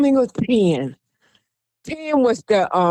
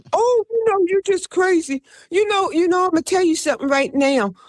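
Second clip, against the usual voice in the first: mocking doubters; 7 semitones higher than usual. Mocking people who doubted Riley.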